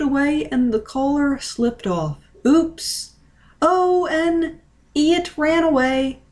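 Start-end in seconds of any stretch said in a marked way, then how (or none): 2.5-6.2 higher, startled exclamation, slowing on the second line; surprised cry. A narrator's reading. Ichigo crying out as the cat runs away. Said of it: "Ichigo cried".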